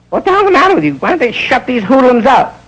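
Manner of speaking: high, irritated outburst; a hint of a fed-up performer; annoyed comedian. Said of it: "he gets bugged".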